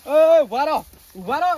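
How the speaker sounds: loud order to halt; commanding shout. Acted. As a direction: call out sharply to stop them. Leader halts the quarrelling men.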